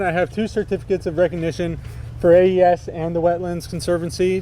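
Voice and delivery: ordinary delivery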